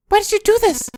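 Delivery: put-on high, upset girl; distraught girl voice. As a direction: demand an answer, shaken. Voices June.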